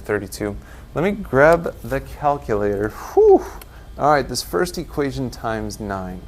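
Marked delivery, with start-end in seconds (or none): 2.9-3.4 whew. Real, not acted. Relief after calculator grab.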